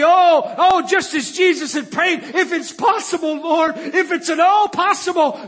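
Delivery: acted anguished prayer; crying out to God. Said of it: "I began to cry out to God".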